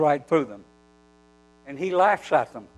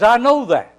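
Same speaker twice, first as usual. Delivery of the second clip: louder, higher emphatic assertion; 7 semitones higher, 7 dB louder than usual. Retold or imitated. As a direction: state it firmly, as the healed man imitated. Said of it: "he speaks very emphatically".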